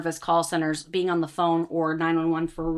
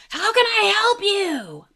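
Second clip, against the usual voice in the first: high, slow, stretched shout into the speaker; mock loud drive-through greeting; loud high call; 4 dB louder, slower than usual. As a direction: belt it over the engine noise. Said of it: "What I do is I get onto the speaker and I go".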